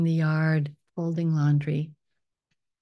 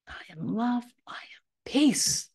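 whispered affirmation; 6 semitones higher than usual. Acted. Guide whispering affirmations to melt hatred, high whisper. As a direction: float the words up in a whisper.

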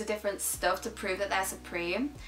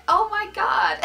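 higher and louder burst of surprise; amused surprise; 8 semitones higher, 7 dB louder than usual. Real, not acted. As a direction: blurt it out, laughing at yourself. Laughing realisation she liked it.

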